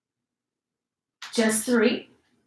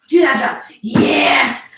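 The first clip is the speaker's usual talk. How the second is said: excited cheering; 5 dB louder, much slower than usual. Real, not acted. Coach cheering on a jump.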